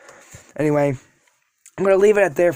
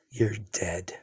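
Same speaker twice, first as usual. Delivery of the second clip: barely voiced menacing whisper; whispered threat; 6 semitones lower, 10 dB quieter than usual. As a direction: hiss the threat under the breath.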